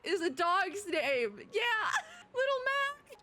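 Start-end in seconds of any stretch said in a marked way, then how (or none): 1.5-3.2 high, slow coo with a laugh, close to tears; teary laughing coo. Real, not acted. Streamer tearing up at the puppy, laughing and cooing. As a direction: choke up over the little dog. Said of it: "I'm gonna cry".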